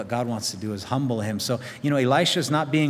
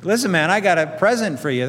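higher, louder pushy offer; offended; 6 semitones higher, 6 dB louder than usual. Pretend. Naaman pushing his present on the prophet, affronted. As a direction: shove the gift at him.

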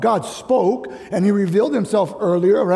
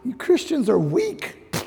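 mocking scoff; 6 semitones higher than usual. Young Kalihi kid mocking Christians, dismissive scoff. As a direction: mimic a young cynic scoffing. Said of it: "I just".

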